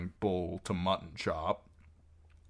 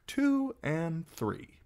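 slow, higher-pitched count; counting aloud; 11 semitones higher, much slower than usual. A narrator's reading. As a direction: draw out each number as you count heads. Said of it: "the singer counted".

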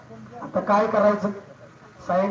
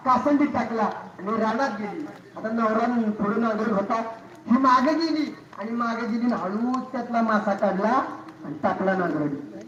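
usual speaking voice

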